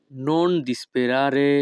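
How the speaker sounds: each Italian word spoken slowly and clearly; teacher modelling Italian for learners, very slow; slow demo. Shown as a demonstration. Bengali teacher models Italian phrase for students, slow and careful. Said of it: "Italian ভাষা".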